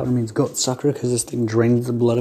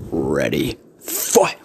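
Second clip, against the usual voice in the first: fight announcer; 11 semitones lower, much slower than usual. Acted.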